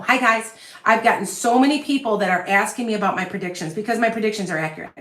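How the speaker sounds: normal talking voice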